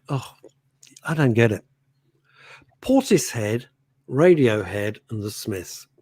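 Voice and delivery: ordinary delivery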